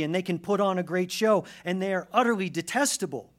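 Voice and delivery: high, loud, impassioned proclamation; full-force preaching. Real, not acted. Preacher exalting God against the world's tyrants, full-force preaching. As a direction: hammer out each phrase to the congregation.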